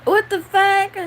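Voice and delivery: high, loud shock; loud, high-pitched yelp of surprise; startled outburst. Real, not acted. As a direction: burst out in sudden disbelief. Sudden outburst after long pause.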